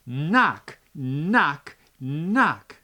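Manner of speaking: loud, punched knock, knock, knock. Acted. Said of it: "knock a little louder".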